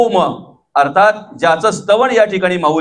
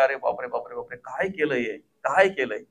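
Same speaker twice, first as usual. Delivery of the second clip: awed gasp; 5 semitones lower, 11 dB quieter than usual. Acted. Ravan marvels at the house.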